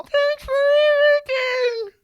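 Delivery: very high, drawn-out whale voice; talking whale. Acted. Whale thanking his rescuer through the app, high wailing voice. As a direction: wail gratitude like a whale. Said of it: "what was it saying?".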